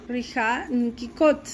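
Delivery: Rija Nkikot said slowly and clearly; slow repeat demo. Shown as a demonstration. (repetición lenta de Rija Nkikot)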